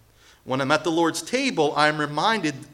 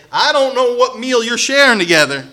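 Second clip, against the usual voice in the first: higher, louder scolding Paul; strong rebuke; 6 semitones higher, 7 dB louder than usual. Retold or imitated. Preacher voices Paul scolding the church, loud and stern. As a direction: give it to them hard, scolding. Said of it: "Apostle Paul has to say".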